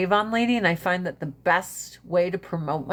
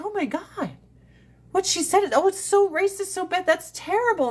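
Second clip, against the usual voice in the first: mock shock; 10 semitones higher than usual. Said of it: "at first I was like".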